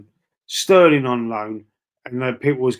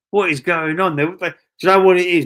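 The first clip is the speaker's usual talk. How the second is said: excited disbelief; 5 semitones higher, 5 dB louder than usual. Real, not acted. Stream views climbing unexpectedly.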